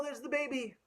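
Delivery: plain reading voice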